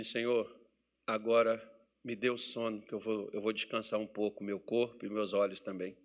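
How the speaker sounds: low, soft, sleepy prayer; oração baixinha na cama. Retold or imitated. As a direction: murmur to God before sleep. Demonstrates quiet bedtime prayer. (oração mansa, grave e baixinha)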